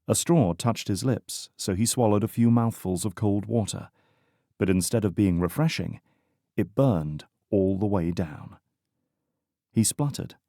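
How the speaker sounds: ordinary delivery, nothing marked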